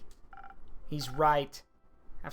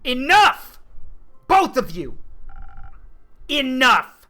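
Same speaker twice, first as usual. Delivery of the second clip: much higher and louder, stopping a fight; commanding shout; 11 semitones higher, 12 dB louder, slower than usual. Acted. Breaking up the quarrel between two companions, commanding shout. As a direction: cut through the argument at full volume.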